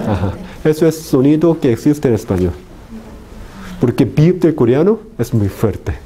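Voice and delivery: usual speaking voice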